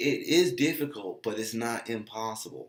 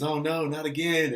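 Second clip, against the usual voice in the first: higher, louder mock dismay; owner's dread; 7 semitones higher, 6 dB louder than usual. Owner seeing another dog coming, mock dread. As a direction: groan at the dog with worry. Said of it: "your initial reaction is".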